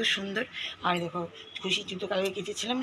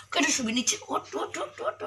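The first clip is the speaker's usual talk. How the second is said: higher, whispery laughing urging; laughing coax; 6 semitones higher than usual. Real, not acted. Mother laughing as she urges her daughter to get up. Playfully rousing child from floor.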